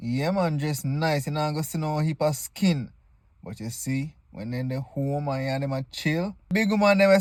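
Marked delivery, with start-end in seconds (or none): none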